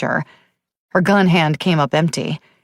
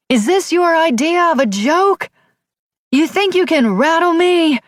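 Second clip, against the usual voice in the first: furious shout; 10 semitones higher than usual. A narrator's reading. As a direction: hurl it up at the sky, enraged. Shouting at the night sky.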